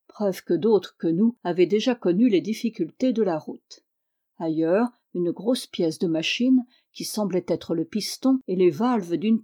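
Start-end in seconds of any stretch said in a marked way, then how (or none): none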